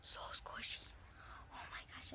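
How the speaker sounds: hushed breathy whisper. Acted. Whispering to viewers while squeezing the squishy. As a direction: murmur it to yourself.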